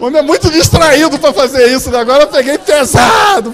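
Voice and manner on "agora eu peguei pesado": loud, high, laughing cry; laughing exclamation. Real, not acted. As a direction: shout it out with a laugh. Pastor exclaims at own joke.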